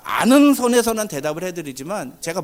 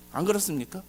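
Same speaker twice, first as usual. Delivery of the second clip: normal talking voice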